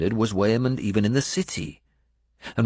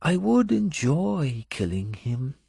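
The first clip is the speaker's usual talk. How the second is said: Benedetta's voice; 9 semitones higher than usual. Acted. Benedetta speaks of her master.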